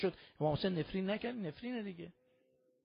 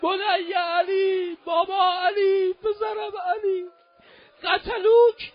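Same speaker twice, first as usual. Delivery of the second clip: slow, much higher crying wail, louder; anguished shout; 9 semitones higher, 7 dB louder, much slower than usual. Acted. Father crying out over his slain son, anguished wail. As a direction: cry the son's name out, voice breaking. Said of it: "داد زد هفت مرتبه".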